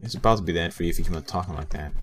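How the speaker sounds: usual speaking voice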